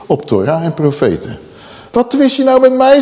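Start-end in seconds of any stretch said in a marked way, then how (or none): 1.9-3.0 high, raised preacher's challenge; indignant rebuke. Acted. Moses confronting the quarrelling people, indignant rebuke.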